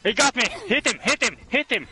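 high, urgent repeated cries; panicked yelling. Real, not acted. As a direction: yell for help as you're grabbed. Grabbed by the killer.

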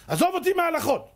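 dismissive outburst. Retold or imitated. Father dismisses the rabbi's law with a high outburst. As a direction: wave the law away.

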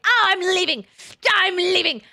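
high, hammed-up mock cry; mock announcing. Streamer mocking viewers who announce they are leaving, high mock yell. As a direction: imitate a viewer loudly announcing an exit.

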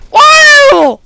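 high, loud whoop of joy; excited whoop. Real, not acted. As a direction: cheer like you just won. Celebrating hardcore moment.

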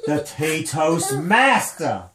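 slow, low hammed-up gangsta boast. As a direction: announce the title like a swaggering MC. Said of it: "or we can have a gangsta tea party".

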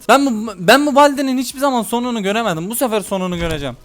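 ordinary talk, nothing marked

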